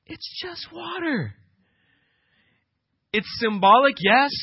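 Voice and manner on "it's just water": high, soft and slow, shrugging it off; John playing down his own work, high and soft; humble aside. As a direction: let it go lightly, almost a sigh. John downplays his baptism.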